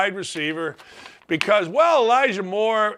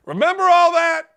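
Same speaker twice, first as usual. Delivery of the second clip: mocking reminder; 9 semitones higher, 5 dB louder than usual. Mocking crowd's past cheering.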